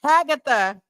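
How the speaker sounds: "Hagatha" said with the voice note, falling at the end; echoing "Hagatha"; saying along. Shown as a demonstration. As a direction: repeat "Hagatha" along with the recording. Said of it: "I gotta say it with her".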